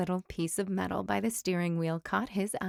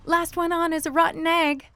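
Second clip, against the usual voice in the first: higher, louder playful challenge; chicken's voice; 9 semitones higher, 4 dB louder than usual. A narrator's reading. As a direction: tease like a racing hen. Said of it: "what she thought was".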